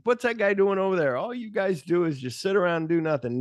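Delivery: high complaining boss, lightly hammed; Dick Miller. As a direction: gripe at lazy workers. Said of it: "Dick Miller. He cracks me up".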